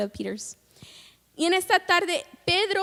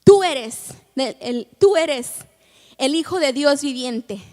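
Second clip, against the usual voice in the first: bold declaration; 7 dB louder than usual. Retold or imitated. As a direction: announce it with full conviction. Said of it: "y les dice".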